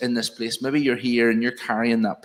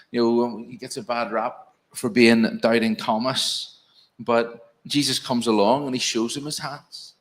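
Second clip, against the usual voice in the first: ordinary talk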